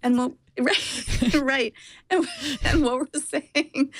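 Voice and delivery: talking through laughter. Real, not acted. Co-host reacting to the mask in a van, laughing. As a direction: laugh while trying to get the point out.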